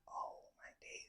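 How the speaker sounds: hushed whisper; whispered dread. Retold or imitated. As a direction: breathe it out in dread. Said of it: "And I was like".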